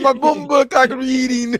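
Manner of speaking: loud, high, phony Jamaican; fake Jamaican accent. Would-be shopkeeper practicing, loud fake Jamaican accent. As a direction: practice a bad Jamaican accent at full volume. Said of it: "so I'm practicing".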